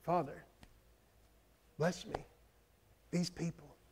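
soft, hushed, timid little prayer; mock-meek murmured prayer; quiet prayer. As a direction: mumble a meek prayer under your breath. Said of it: "like a quiet prayer".

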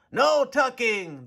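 the crew cries it out together; crew cry. A narrator's reading. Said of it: "the crew cried".

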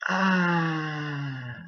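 long drawn-out "ah" of defeat; dismayed wail. Real, not acted. Streamer has just lost the round, long dismayed wail. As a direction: wail it out, sliding slowly downward. After losing in the game.

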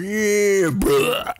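loud, high hammed-up holler; mock yell. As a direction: blurt it out loudly as a joke. Acts out reaction round the corner.

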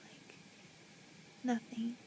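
soft tender whisper. Real, not acted. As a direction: breathe it lovingly. Whispering over the baby.

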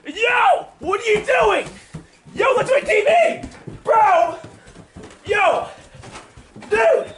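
high, disbelieving protest; alarmed shouting. Real, not acted. Vlogger sees Tyler at the TV, high alarmed protest. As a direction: cry out in real alarm.

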